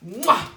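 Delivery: big smacking kiss, high and loud. Acted. Winifred kissing the monster, a loud smacking kiss. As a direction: plant a loud cartoon kiss.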